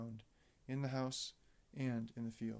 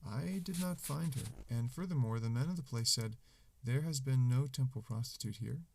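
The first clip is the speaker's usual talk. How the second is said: loud, projected scripture reading; Bible reading; 9 dB louder than usual. Acted. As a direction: read scripture out to a room.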